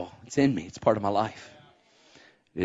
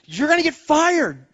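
worried wife; 5 dB louder than usual. Retold or imitated.

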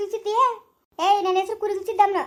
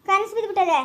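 normal talking voice

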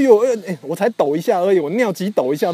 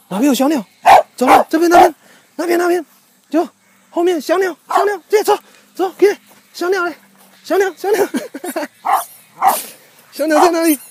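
much higher, eager calls to the dog; excited pet urging; 10 semitones higher than usual. Real, not acted. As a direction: egg the dog on to chase the birds. Urging dog to find birds.

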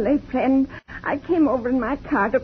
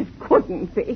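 ordinary delivery

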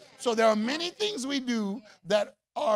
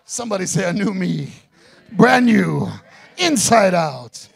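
preaching at full force; 2 semitones lower, 11 dB louder than usual. Acted.

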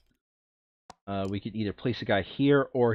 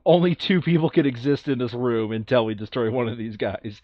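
ordinary delivery

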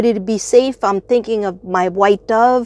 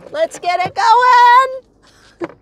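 much higher, very slow; excited sing-song; 12 semitones higher, much slower than usual. Real, not acted. Vlogger lighting Palo Santo, gleeful drawn-out cheer. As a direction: stretch it out, gleeful.

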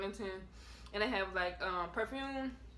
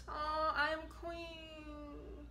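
slow, soft and higher, close to tears; emotional; 7 semitones higher, 4 dB quieter, much slower than usual. Real, not acted. Repeating her late aunt's book title, moved to tears. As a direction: let the words catch as grief rises. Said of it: "Feel a little emotional".

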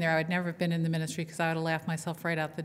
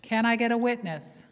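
louder call to the congregation; preacher's louder call; 4 semitones higher, 4 dB louder than usual. Shown as a demonstration. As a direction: push the call out, demanding an answer.

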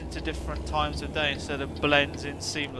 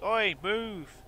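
mock impatient driver; 6 semitones higher, 4 dB louder, slower than usual. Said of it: "They're like".

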